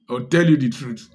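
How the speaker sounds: normal talking voice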